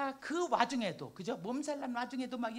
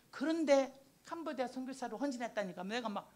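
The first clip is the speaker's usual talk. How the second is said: usual speaking voice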